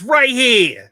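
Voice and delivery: put-on Brooklyn accent. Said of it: "You mean my, my Brooklyn accent?".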